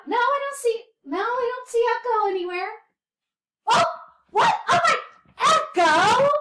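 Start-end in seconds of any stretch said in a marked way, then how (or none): 3.7-6.3 loud, high surprised call; surprised exclaim. Pretend. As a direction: call out the puppet's name in surprise. Discovers puppet prank.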